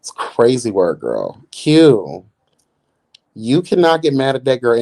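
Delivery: ordinary talk, nothing marked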